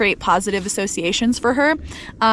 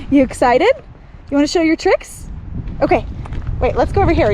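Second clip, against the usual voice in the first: excited dog talk; 4 semitones higher than usual. Real, not acted. Owner cheering on her dog before tricks, excited.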